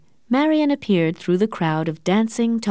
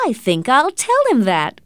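higher, louder, gleeful outburst; delighted cry; 5 semitones higher, 5 dB louder than usual. A narrator's reading. Marian teasing her cousin about Morris, delighted and loud. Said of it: "cried Marian delightedly".

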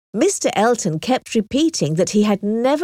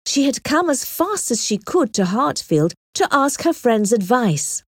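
ordinary delivery, nothing marked

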